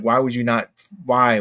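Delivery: usual speaking voice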